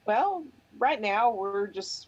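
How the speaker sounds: ordinary talk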